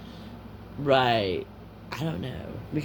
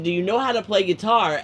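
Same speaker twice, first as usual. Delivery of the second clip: raised, higher-pitched heated question; heated reproach; 4 semitones higher, 4 dB louder than usual. Retold or imitated. Friend quoting the earlier question to Sherry, heated reproach. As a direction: throw the question back at them, pitched up. Acted quarrel after crying accusation.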